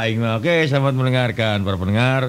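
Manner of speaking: ordinary talk, nothing marked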